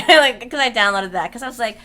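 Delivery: ordinary talk, nothing marked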